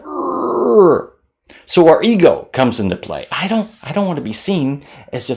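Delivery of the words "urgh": disgusted groan. Retold or imitated.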